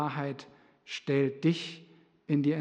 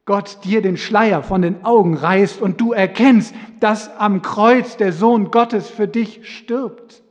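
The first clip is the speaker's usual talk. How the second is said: fervent preaching; 4 semitones higher, 10 dB louder than usual. Acted. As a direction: press the hope onto every listener. Preaching appeal.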